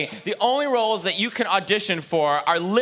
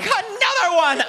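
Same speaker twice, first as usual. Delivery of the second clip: dad's despairing cry; 13 semitones higher than usual. Retold or imitated. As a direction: cry out like a father in mock horror. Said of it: "wakes up in the middle of the night like".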